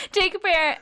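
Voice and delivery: ordinary delivery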